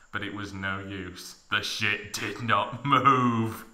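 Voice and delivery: usual speaking voice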